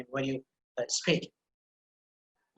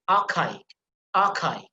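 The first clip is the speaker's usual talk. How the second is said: "archaic" with CHA, said "kay", emphasized; stress on KA; slower than usual. Shown as a demonstration. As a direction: lean on the k syllable in "archaic". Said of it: "ka sound is emphasized".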